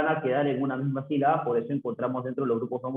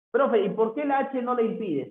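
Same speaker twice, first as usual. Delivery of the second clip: student asking; 8 semitones higher than usual. Acted. Teacher voices a student's question.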